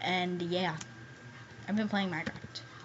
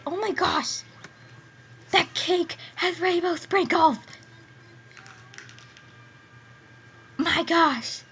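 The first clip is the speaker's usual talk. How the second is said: excited squeal; 5 semitones higher, slower than usual. Real, not acted. Gushing over a rainbow cake.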